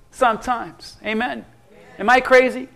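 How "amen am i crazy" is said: usual speaking voice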